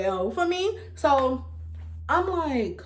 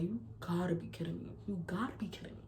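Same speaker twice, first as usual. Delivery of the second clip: much softer, murmured in disbelief; stunned disbelief; 4 semitones lower, 14 dB quieter, faster than usual. Retold or imitated. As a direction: say it under your breath, stunned. Staring at test in disbelief.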